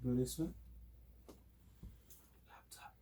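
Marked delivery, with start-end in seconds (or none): 2.5-2.9 breathy unvoiced whisper. Shown as a demonstration. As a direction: whisper it so it is barely audible. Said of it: "we're gonna do a whispering test".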